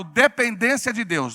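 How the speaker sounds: loud, slow declaration for repeating; loud prompt. Shown as a demonstration. Preacher prompting congregation to repeat it, loud declaration. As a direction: call out the key phrase. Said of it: "fala bem alto".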